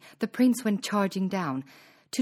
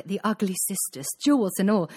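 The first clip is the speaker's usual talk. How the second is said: usual speaking voice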